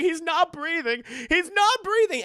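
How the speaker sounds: high, loud, rising mock panic; panicked cry. Best friend finding Sherman shot, panicked cry.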